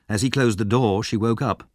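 plain reading voice

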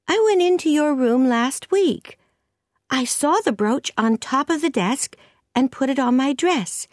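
put-on high-pitched girl explaining herself; high, innocent young voice; earnest young Anne.

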